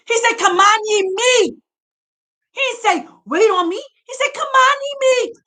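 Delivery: rising, shouting sermon delivery; preaching. Acted. Preacher urging Bantus to leave, fiery and shouting. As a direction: preach it hard to the congregation. Preacher exhorting congregation at full force.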